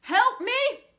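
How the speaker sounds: very high, loud desperate cry for help; the stuck pastor begs the sexton, shouting high. A narrator's reading. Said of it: "shouted the pastor".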